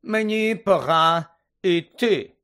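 drawn-out chilly announcement from a haughty lady; cold Madame Maxime. A narrator's reading. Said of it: "голос мадам Максим був набагато холодніший".

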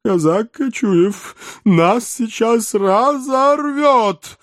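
high, slow, panicky cry; panicked. Acted. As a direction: shriek it in alarm. Panicked elf in a chase.

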